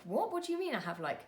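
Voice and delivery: retold disbelief, pitch leaping high then dropping away; incredulous questioning, acted out in retelling; taken aback. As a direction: re-enact a startled double-take. Retelling her shocked reaction to partner.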